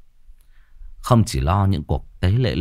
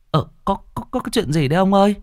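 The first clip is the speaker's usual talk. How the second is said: alarmed wife; 12 semitones higher, much faster than usual. Acted.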